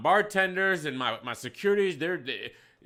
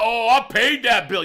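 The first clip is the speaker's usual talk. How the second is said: mocking quote; 6 semitones higher, 7 dB louder than usual. Quotes the customer's excuse.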